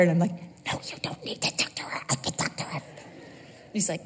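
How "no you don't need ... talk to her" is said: hushed, unvoiced whisper. Wife to husband on the phone, fast whisper.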